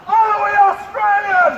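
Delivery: high-pitched call to a crowd; high, ringing rally cry; rallying shout. Real, not acted. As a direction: shout it as a question the crowd must answer. Calling to crowd for response.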